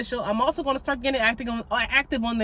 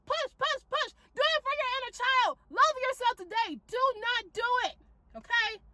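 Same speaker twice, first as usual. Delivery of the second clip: pleading encouragement; 10 semitones higher, 5 dB quieter than usual. Shown as a demonstration. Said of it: "conversations I will have myself".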